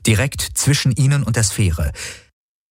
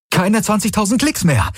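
much higher, exultant announcement; jubilant; 13 semitones higher than usual. Acted. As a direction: cheer the distance like good news. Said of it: "jubelte Galen".

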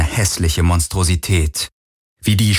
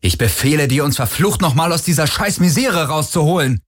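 high, forceful command; 8 semitones higher than usual. A narrator's reading. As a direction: order it with new confidence. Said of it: "mit mehr Selbstsicherheit".

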